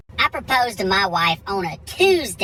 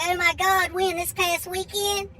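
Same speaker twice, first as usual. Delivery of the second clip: much higher, gushing waitress voice; mock a waitress thrilled for the couple; excited waitress; 11 semitones higher, slower than usual. Waitress congratulating newly engaged diners, high and excited. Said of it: "the waitress comes up".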